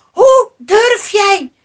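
high, loud, emphatic reproach; outraged shouting. A narrator's reading. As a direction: shout your disbelief at his nerve. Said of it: "riepen de mannen meteen". (verontwaardigd, luid roepen)

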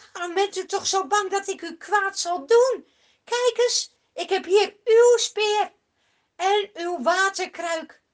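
loud, high pleading shout; David calling to King Saul. Acted. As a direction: plead across the distance with the king. David calling across the valley.

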